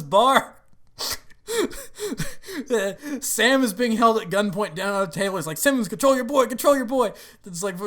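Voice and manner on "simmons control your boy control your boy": high, louder imitated pleading; mock panicked plea. Sam held at gunpoint, mock panicked plea. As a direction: act out urgently begging for help.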